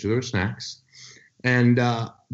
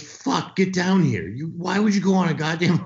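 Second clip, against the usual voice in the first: furious doctor; 8 semitones higher than usual. Retold or imitated. Doctor yelling at the patient to come in, mock shout. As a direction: bark it like an outraged doctor. Angry doctor voiced in story.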